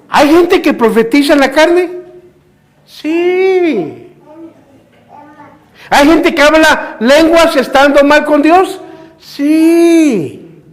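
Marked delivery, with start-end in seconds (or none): 2.9-4.1 "Sí" stretched long and high; emphatic answer. Real, not acted. Preacher answers his own question. 9.2-10.6 "Sí" stretched long and high; emphatic answer. Real, not acted. Preacher answers his own question.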